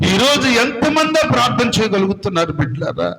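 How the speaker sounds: usual speaking voice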